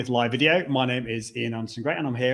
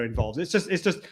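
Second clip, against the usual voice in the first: usual speaking voice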